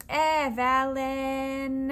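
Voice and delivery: stretched Valen; slow syllables. Shown as a demonstration. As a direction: draw out each syllable. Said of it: "It's the eh sound".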